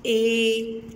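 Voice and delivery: ए held long and even; long vowel. Shown as a demonstration. Teacher showing children the long ए sound. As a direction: stretch the ए out like a sung note. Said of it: "लंबा करके पढ़ते हैं".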